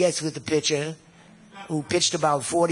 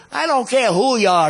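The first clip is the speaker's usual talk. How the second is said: loud, higher retort from the hotel manager; angry manager; 7 semitones higher, 8 dB louder than usual. Retold or imitated. Hotel manager yelling back at Serge, loud. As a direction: snap back at him loudly. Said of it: "He says".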